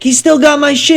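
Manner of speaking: high, urgent reenacted reply; acting out worked-up insistence; frantic retold protest.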